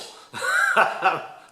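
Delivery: a high burst of real laughter; loud amused laugh; big laugh. Real, not acted. Laughing at builder's unhurried billing.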